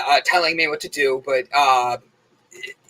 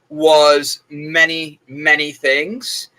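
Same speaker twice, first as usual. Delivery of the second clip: slow; much slower than usual. Real, not acted. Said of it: "Informative is the word I'm gonna use".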